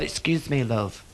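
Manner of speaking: soft, lightly camp man's voice; a hint of a camp fella at the bar. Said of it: "I'm only acting when I do this".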